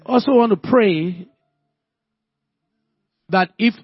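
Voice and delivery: loud, fervent preaching voice. Acted. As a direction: pray it out loud with full force. Prays aloud at full force.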